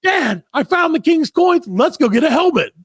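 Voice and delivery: put-on excited kid. Son telling dad he found the king's coins, high and eager. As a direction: play the thrilled little son. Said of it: "my son's like".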